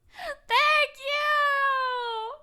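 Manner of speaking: very high, long squealed thank-you; high gushing holler; effusive thanks. Real, not acted. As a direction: squeal your thanks and hold the last word. Streamer thanks a supporter.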